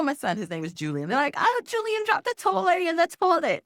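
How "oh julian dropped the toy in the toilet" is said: very high mock yell; imitated yelling. Family yelling up about the son's mishap, mock yelling. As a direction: report the mishap in a high, alarmed call. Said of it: "they're yelling".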